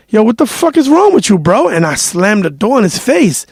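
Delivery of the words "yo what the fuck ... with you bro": mock-angry, higher-pitched challenge; half-serious retold confrontation; retold heated outburst. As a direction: replay your irritated comeback, pitched up. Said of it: "I was so fucking heated".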